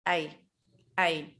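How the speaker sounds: short I in the mouth; Dutch ei. Shown as a demonstration. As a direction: say ei short and forward. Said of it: "pronounced in the mouth".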